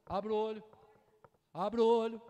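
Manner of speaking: loud, high, slowed preaching cry; fiery preaching. Acted. Pastor urging the church at full preaching force. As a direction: build up and shout it to the church.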